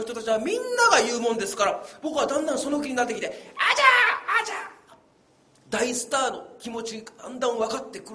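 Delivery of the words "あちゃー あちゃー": high-pitched kung fu yell; Jackie Chan shout. Pretend. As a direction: squeal it like a movie fighter. Playing Jackie Chan kiai.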